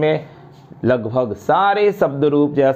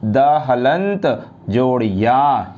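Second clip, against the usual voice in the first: slow, syllable by syllable; teacher breaking the word into parts; slow spelling out; slower than usual. Shown as a demonstration. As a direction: spell it out slowly for students. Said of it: "यहाँ आप ध्यान देंगे".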